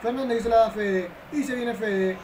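ordinary talk, nothing marked

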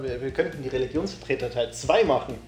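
ordinary delivery

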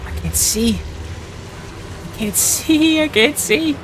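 high, frightened pleading; panicked whimper. Real, not acted. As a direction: whimper it, scared in the dark. Panicked in darkness.

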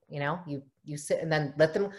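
normal talking voice

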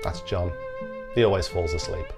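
ordinary delivery

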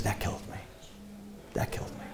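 whispered, heartbroken; man recalling his father's words, whispered; hushed whisper. Retold or imitated. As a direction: breathe it out, crushed. Retelling in hushed grief.

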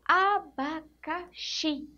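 Abacaxi said very slowly, a-ba-ca-xi each syllable stretched. Shown as a demonstration. Teacher sounding out abacaxi for pupils, very slowly. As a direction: sound out the whole word slowly. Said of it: "o som da letrinha C".

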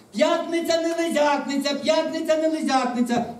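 high, loud singsong announcement; calling out. A narrator's reading. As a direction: call it out over a crowd beyond the gate. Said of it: "за воріт вона гукнула".

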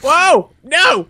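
high, loud yelp; alarmed shout. Real, not acted. Player surprised by creepers, alarmed high shout. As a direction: cry out at sudden danger.

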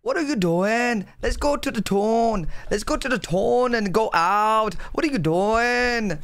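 sing-song attempt at a Welsh accent. Shown as a demonstration. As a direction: lilt it like someone Welsh.

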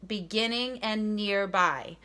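"beginning" and "nearby" set apart, "nearby" slow; emphasizing words. Shown as a demonstration. Teacher to class, stressing the key words. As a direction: lean on "beginning" and "nearby" one at a time. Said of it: "I'm emphasizing".

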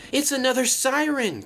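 high-pitched, alarmed gasp; panicked gasp. A narrator's reading. Luke hearing a piercing siren, gasping in panic. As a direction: gasp it out in sudden fright. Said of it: "gasped Luke".

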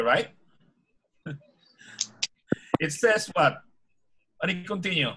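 ordinary talk, nothing marked

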